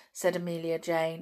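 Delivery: ordinary delivery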